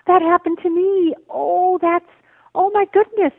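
high, excited gasp of recognition, acted out; amazed recognition. A reader finding herself in someone else's story, acting amazed.

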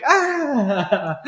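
hammed-up scream dissolving into laughter; mock pained scream. Imitating Jack as if shot in the ear, mock scream. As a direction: cry out in exaggerated pain, then laugh. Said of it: "you get Jack going".

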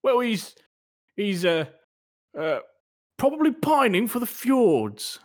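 put-on British accent. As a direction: fumble for an excuse in British. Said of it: "We're gonna try our British accents".

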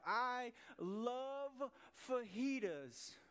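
slow, drawn-out gushing over a favorite food; gushing food love. Shown as a demonstration. Pastor gushing to the congregation about fajitas, drawn out. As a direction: savor every word like a foodie. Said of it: "if I use that same tone".

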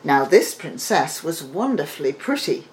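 usual speaking voice

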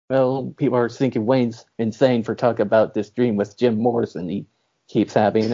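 ordinary talk, nothing marked

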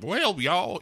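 high, over-the-top Southern drawl; exaggerated Southern accent. Shown as a demonstration. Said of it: "it's not that kind of Southern accent".

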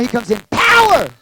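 loud preaching shout on POWER; powerful shout. Real, not acted. Preacher demonstrates coming in power.

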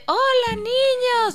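put-on high sing-song hello to kids; mock kids' entertainer. Mocking how adults talk down to kids, high sing-song. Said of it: "cuando tratan a los niños de".

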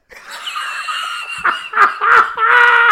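big high-pitched burst of laughing; loud laughter. Real, not acted. Friends cracking up over their satay debate, loud laughter.

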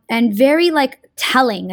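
ordinary talk

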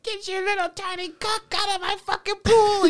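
put-on mother shouting at kids from her trailer window; yelling mom. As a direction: screech it out a window like an angry mom. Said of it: "mother yelling out the".

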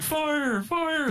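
high-pitched mock calling, drawn out; calling out for dog. Man out hollering for his dog Fire, mock calling. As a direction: call the dog's name in a high voice. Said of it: "going out there being like".

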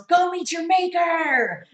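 high, loud, slow movie-hero shout; action hero line. Retold or imitated. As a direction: bellow it like a cartoon hero. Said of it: "and the good guy comes".

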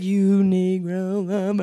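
low, slow mocking accusation; imitating disapproving people. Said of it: "they're like".

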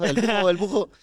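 "El bujo, el bujo" with the R turned into a J sound, high and teasing; mocking a lisp. Speaker mocking someone who can't say R, childish mispronunciation. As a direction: imitate a kid who can't say R. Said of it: "alguien que no pronuncia la R".

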